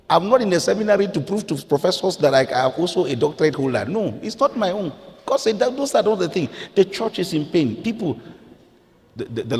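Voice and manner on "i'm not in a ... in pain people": high, ringing, urgent preaching; impassioned preaching. Real, not acted. Pastor moved as people wipe tears, impassioned. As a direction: lift it with rising passion.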